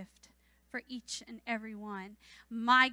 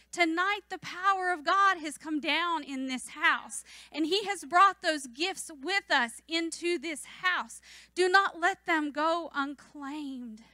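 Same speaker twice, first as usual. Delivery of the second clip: rising, louder preaching push; 3 semitones higher, 7 dB louder than usual. Acted. Preacher urging the congregation not to waste God's gifts, fervent preaching. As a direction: drive it home to the church.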